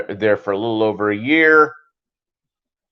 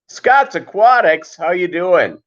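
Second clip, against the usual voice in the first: excited shout-out; 6 semitones higher, 3 dB louder, slower than usual. Real, not acted. Calling out a viewer's name.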